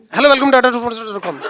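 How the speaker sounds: clipped, hurried welcome swallowed into dot com; teasing a colleague who always rushes his intro; mock rushed greeting. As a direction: rattle off the channel greeting like a man in a hurry.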